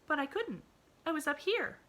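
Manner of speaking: put-on bright, high little girl voice; eager child reassuring her friend; cheerful little girl. A narrator's reading. Pollyanna voiced in story.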